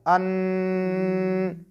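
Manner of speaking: अन sung long through the nose; nasal ghunna. Shown as a demonstration. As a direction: hold अन as a nasal hum. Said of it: "सारी आवाज़ नाक में".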